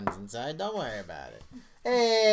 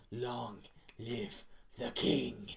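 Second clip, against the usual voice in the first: very slow, softer, low Scar line; quiet menacing villain; 4 semitones lower, 7 dB quieter, much slower than usual. Retold or imitated. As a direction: murmur the betrayal coldly. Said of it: "he's like".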